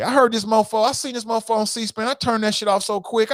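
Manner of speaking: normal talking voice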